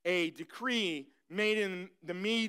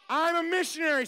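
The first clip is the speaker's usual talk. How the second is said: higher, louder I'm a missionary as a chant lead; louder call-and-response; 7 semitones higher, 4 dB louder, faster than usual. Shown as a demonstration. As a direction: push I'm a missionary up for the kids to echo. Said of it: "Say it".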